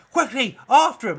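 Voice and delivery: loud, high shouted order; urgent command. Acted. Cop orders the others to chase, shouting. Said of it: "And it's like".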